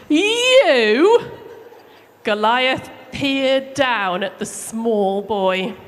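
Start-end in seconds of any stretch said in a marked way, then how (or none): 0.1-1.3 high, loud, scornful giant's "You?". Acted. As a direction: sneer down at the tiny boy as the giant. Giant sneers at small boy.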